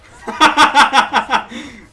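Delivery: high burst of loud laughter. Real, not acted. Laughs at the boss ending.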